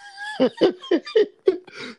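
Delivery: squealing burst of laughter; high-pitched laugh. Real, not acted. Cracks up after reading her quote.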